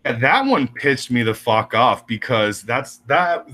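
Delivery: ordinary talk, nothing marked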